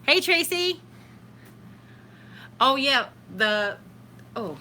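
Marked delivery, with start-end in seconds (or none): 2.6-4.6 wincing mid-sentence; having a back spasm. Real, not acted. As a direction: voice catching from sudden back pain. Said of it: "My back just went".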